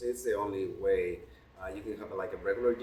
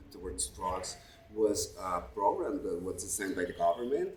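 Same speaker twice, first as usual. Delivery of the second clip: ordinary talk, nothing marked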